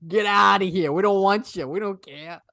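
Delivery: put-on brash, dismissive Boston accent. Boston local turning away a university applicant, brash Boston accent. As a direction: send them packing in broad Boston. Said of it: "That was my best Boston accent".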